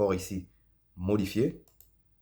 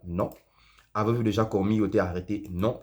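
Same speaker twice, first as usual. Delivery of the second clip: ordinary delivery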